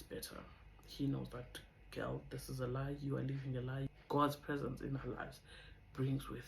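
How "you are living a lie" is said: normal talking voice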